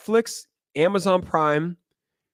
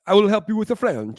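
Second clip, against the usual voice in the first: higher-pitched put-on foreign accent; mock foreign accent, pitched up; imitating LFG's English; 6 semitones higher than usual. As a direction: mimic a non-native English speaker. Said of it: "Read this in L F G's".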